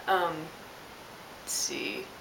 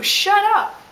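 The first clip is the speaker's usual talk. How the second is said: "Shut up" much higher and louder, falling; mock yell; 11 semitones higher, 10 dB louder than usual. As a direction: snap "Shut up" at him as a shriek. End of the imagined rant.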